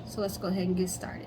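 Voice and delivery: hushed lead-in; whisper. Real, not acted. Host starts the story for viewers, whispering. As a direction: whisper it like a secret beginning.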